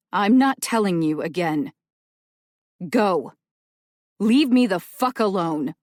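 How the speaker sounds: loud final warning; furious order. Acted. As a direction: bark it, last chance. Rowan ordering Dell out.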